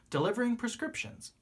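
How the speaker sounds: plain reading voice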